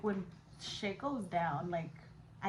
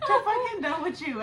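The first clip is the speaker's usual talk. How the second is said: laughing; 8 semitones higher, 7 dB louder than usual. Real, not acted. Laughing recalling emergency room trip.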